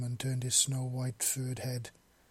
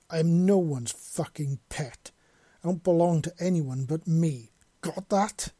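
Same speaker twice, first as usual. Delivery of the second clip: raised, louder voice, rising to a sharp demand; angry yell; 4 semitones higher, 7 dB louder than usual. A narrator's reading. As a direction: yell it indignantly to make them all jump. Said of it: "he yelled".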